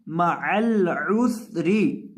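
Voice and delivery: माअल उसरी drawn out slowly, words joined; slow correct recitation. Shown as a demonstration. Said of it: "इन अल्फाज की अदायगी किस तरह होगी". (धीमी सही अदायगी)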